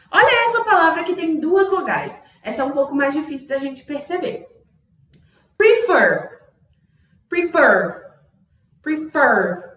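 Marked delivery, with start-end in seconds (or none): 5.6-9.7 Prefer with a strong, punched second syllable; stress on fer. Shown as a demonstration. Teacher showing which syllable is stronger, stress on fer in Prefer. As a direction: hit FER harder than pre in Prefer. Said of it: "fer tá bem mais forte".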